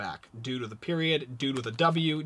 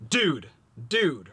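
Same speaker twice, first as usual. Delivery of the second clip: firm, louder plain dudes; just say it louder; louder, straightforward. Shown as a demonstration. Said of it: "say it a little louder, but straightforward".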